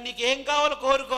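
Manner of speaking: God's offer, high and loud; booming voice of God. Acted. God telling young king Solomon to ask anything, booming. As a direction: proclaim it like God granting a wish.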